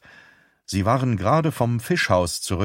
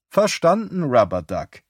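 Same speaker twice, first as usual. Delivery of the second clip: trucker radio sign-off; 7 semitones higher, 3 dB louder than usual. Acted. Beth quotes a trucker song.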